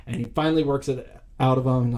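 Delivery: normal talking voice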